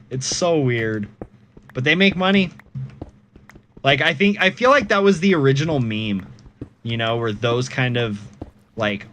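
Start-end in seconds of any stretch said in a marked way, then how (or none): none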